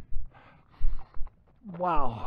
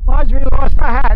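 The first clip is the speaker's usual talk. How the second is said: raised voice against the wind; shouting over wind; 5 semitones higher, 15 dB louder than usual. Real, not acted. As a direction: call it out loud and high over the gale.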